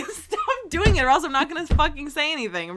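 half-laughing, loud protest; laughing scold. Real, not acted. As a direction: laugh through the complaint. Laughing while scolding friend.

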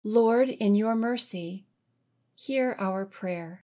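usual speaking voice

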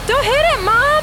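high panicked shriek; panicked shout. Acted. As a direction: scream a warning in alarm. Log ahead in water.

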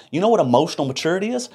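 ordinary talk

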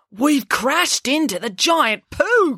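high, slow astonished cry; cried out. Acted. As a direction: stretch it out in disbelief. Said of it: "cried Finn".

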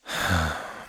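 low, soft weary sigh; dejected sigh; heavy sigh. A narrator's reading. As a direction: let out a defeated sigh. Clown feels awful.

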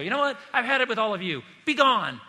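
high, loud exasperated outburst; fed-up dismissal. Shown as a demonstration. Jesus misread as fed up with everyone, loud exasperated. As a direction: snap that you've had enough. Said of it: "be careful that you don't turn that in".

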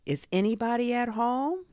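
high call rising to a long end. A narrator's reading. Bear calls into a burrow, calling out. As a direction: call down into a hole. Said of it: "and called out".

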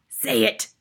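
forceful headmistress order; Trunchbull bellow. A narrator's reading. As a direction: bellow the demand. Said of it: "bellowed the Trunchbull".

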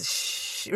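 soft unvoiced shh like air leaving a tire; tire hiss shush. Shown as a demonstration. Teacher showing the flat tire noise, soft shh. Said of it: "makes that noise".

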